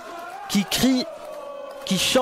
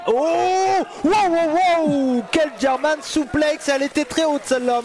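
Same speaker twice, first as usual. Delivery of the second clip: excited shout; 7 semitones higher than usual. Real, not acted. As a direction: erupt at the big throw. Commentator reacting to big throw. (cri d'excitation)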